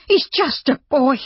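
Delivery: very high, loud protest; passionate cry. A narrator's reading. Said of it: "Hilda cried passionately".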